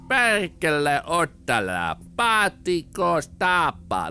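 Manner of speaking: loud, drawn-out mock Finnish talk; slow Finnish characters. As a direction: imitate slow-talking Finnish game characters, stretching every word. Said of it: "they sound like they talk slower".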